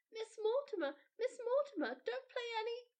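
put-on high, loud child's call; a girl calling across the room to her nurse; Mildred calls out urgently to Miss Mortimer. A narrator's reading. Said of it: "She called aloud to me".

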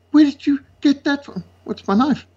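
high, scandalised church elder; shocked gasp. Retold or imitated. Church elder shocked by a pocket knife, high and aghast. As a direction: mimic an appalled elder, pitched well up. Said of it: "one of the elders goes, gasp".

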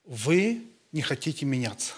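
ordinary talk

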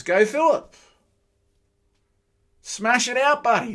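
high, loud encouragement; cheering on. Real, not acted. Preacher cheering Philip on from the sidelines, excited. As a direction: egg him on like a mate.

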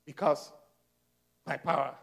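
low, soft, measured voice; God speaking. Acted. God telling Paul about grace, low quiet assurance. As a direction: explain quietly, pausing between phrases. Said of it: "God said".

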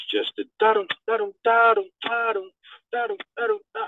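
high sung da-dum beat; high imitated da-dum hook; imitating a beat. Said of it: "[name]'s voice".

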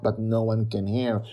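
plain reading voice

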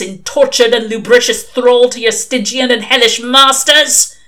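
loud, high hellfire rant; thundering accusation. Acted. Old witchfinder mishears the name as Lucifer, thundering accusation. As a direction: thunder it like a fire-and-brimstone elder.

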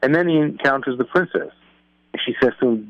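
ordinary talk

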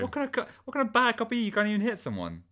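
very high, exasperated outburst; incredulous scolding. Acted. Berating the bad cop, high incredulous voice. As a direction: squawk in disbelief at a useless partner. Mocking partner's refusal to hit.

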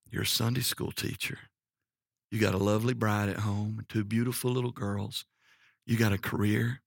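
soft, low, hushed voice of God; imitated whisper to the heart. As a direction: quietly remind him, pausing between phrases. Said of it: "whispered to my heart".

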